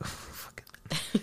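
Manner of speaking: half-whispered drawn-out reaction; breathy whispered aside. Retold or imitated. Watching the movie, quietly half-whispering to himself, slow. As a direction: hush it out slowly, almost soundless.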